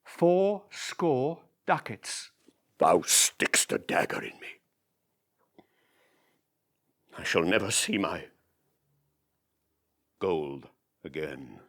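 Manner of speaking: slow, pained, dagger in the heart; wounded Shylock. Acted. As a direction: drag out the loss. Shylock stung by loss.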